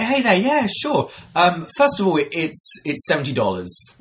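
high, bright mock-sweet greeting; fake-friendly bartender. Bartender greeting the dine-and-dasher, mock-sweet. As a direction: sweetly set the trap.

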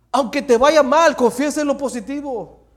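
loud, high exhortation; forceful preaching. Real, not acted.

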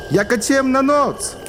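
high, slow, awed peasant; awed villager. Acted. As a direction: marvel at the dark night. Julek in dark night. (zachwycony chłop)

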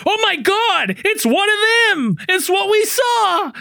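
high, drawn-out hammy alarm; mock panic. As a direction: overact a horrified discovery. Acting out panicked film character.

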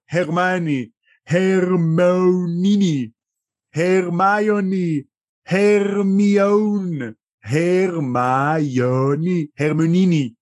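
הרמיוני sounded out slowly, syllable by syllable; drawn-out הרמיוני with mangled הרמוניני takes; slow teaching pronunciation. Retold or imitated. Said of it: "מלמדת את קרום איך לומר את השם שלה". (הרמיוני נאמר לאט ובהברות)

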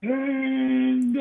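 high, long call across the golf course; mock faraway call for Tango; distant call for dog. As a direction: shout the dog's name from far away. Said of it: "screaming for Tango".

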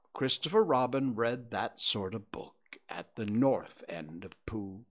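plain reading voice

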